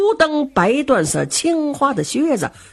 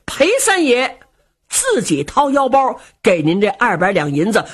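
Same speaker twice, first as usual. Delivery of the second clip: ordinary delivery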